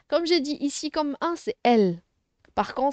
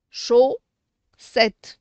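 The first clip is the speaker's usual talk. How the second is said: "Chaussette" split in two loud beats; syllable by syllable; 8 dB louder than usual. Shown as a demonstration. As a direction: pause between "Chau" and "ssette". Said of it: "on fait toujours les syllabes".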